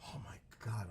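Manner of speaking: breathless hushed oh my God; a whisper of craving; awed whisper. Real, not acted. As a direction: whisper it in awe. Reacting to the food on screen.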